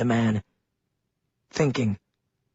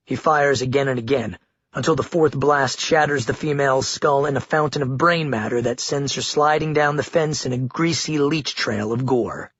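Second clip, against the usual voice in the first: usual speaking voice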